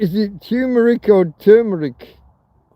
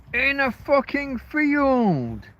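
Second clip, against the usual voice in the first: exasperated punchline; 9 semitones higher, 4 dB quieter, slower than usual. Real, not acted. Punchline about living in field.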